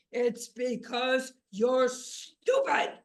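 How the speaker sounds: huge, slow, very loud pronouncement; thunderous verdict from on high, drawn out; booming voice. A narrator's reading. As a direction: boom it out like God filling the air. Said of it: "this booming voice filled the air".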